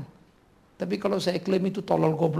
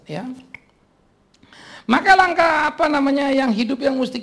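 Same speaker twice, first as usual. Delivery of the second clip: raised, loud pulpit exhortation; preaching at full force; 7 semitones higher, 5 dB louder than usual. Acted. Preacher urging the congregation in the final point. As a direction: call the congregation to act.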